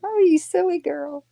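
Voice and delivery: high, fond pet-talk voice; talking to the cat. Real, not acted. Cooing at her cat.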